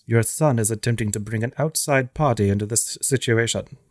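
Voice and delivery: measured British waiter's diction with bold R's; British Grady impression. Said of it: "I love his bold R's. It's great. It's so British".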